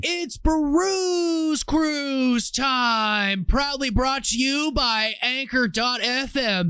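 booming ringmaster intro, drawn out. Acted. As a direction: announce it like a circus ringmaster. Show intro announcement.

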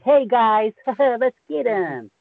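high, loud parody hero call; mock goody-goody Ranger. Mocking how Rangers don't sound, high cheesy hero voice. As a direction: spoof an eager hero, drawn out at the end. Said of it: "our Rangers don't sound anything like".

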